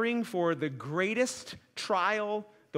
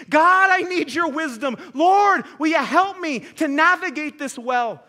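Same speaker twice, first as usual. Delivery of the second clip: loud and higher, climbing in fervour; impassioned prayer; 5 semitones higher, 8 dB louder than usual. Acted. Preacher voicing a believer's plea to God, fervent and loud. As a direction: pour it out to God, pleading louder.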